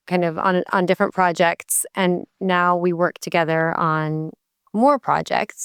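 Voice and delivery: ordinary delivery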